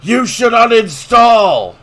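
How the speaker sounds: slow, high mocking yell; raging gamer. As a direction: bellow it like a sore loser.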